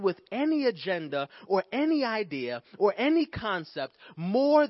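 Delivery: rising preacher's cadence, pushing toward a loud peak; preaching. Acted. Preacher building to his congregation, rising to a shout.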